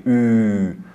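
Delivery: او pushed slowly with strain; forced ü. Shown as a demonstration. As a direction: overdo او with effort. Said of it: "بخوایم به خودمون فشار بدیم". (او با فشار و کشیده)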